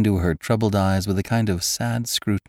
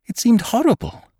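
far higher and louder, near breaking; horrified outburst; 6 dB louder than usual. Acted. Girl repeating her horror to Cairn, near breaking. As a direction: cry it out in horror.

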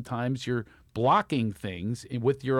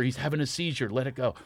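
ordinary talk, nothing marked

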